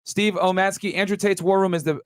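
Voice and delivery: usual speaking voice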